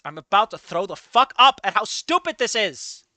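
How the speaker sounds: loud, rising yell of disgust; loud, fed-up rant; exasperated outburst. Real, not acted. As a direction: explode with it, hitting STUPID. Repeats it as an outburst.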